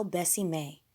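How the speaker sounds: usual speaking voice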